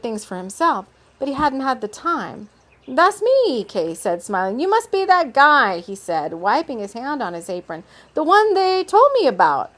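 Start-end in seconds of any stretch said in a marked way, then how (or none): 2.9-3.6 high, loud, pleased character voice. A narrator's reading. Kay owns up to Dakar, high smiling voice. 4.6-5.8 high, loud, pleased character voice. A narrator's reading. Kay owns up to Dakar, high smiling voice. Kay greets expected visitor. 8.1-9.7 high, loud, pleased character voice. A narrator's reading. Kay owns up to Dakar, high smiling voice. Kay greets expected visitor.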